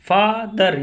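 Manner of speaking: Father in two beats, long फा then दर; teacher sounding out both parts of father; split into syllables. Shown as a demonstration. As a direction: break father apart for the class. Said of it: "यानी दो बार का उच्चारण हुआ".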